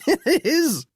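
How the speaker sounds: laughing through the words, high; amused, chuckling reply. A narrator's reading.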